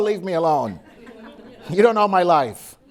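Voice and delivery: ordinary delivery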